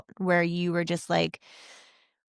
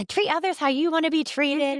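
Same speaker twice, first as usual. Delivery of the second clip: put-on much higher, louder sing-song kid recital; imitating her kids; 10 semitones higher, 3 dB louder than usual. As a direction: parrot it back like a dutiful child. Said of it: "They're like".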